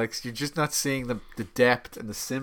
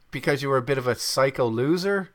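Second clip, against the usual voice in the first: ordinary talk, nothing marked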